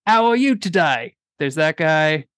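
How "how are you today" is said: high, hammed-up Cockney; Cockney chimney sweep. Host demonstrating British accents, Cockney sweep. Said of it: "like, the Cockney chimney sweep".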